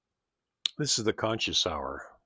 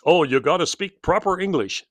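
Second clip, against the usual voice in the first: mock teacher; 6 semitones higher, 7 dB louder than usual. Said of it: "it was English class".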